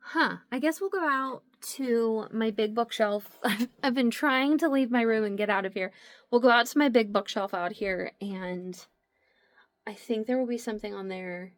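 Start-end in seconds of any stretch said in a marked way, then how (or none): none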